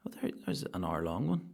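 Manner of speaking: ordinary talk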